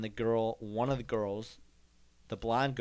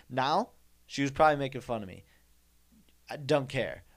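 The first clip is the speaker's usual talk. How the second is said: ordinary delivery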